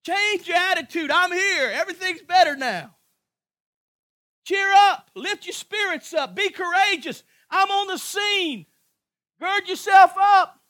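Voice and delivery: loud, high, rousing sermon climax; full-force preaching. Acted. Preacher rallying the congregation, loud and high. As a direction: hammer it home like a revival preacher.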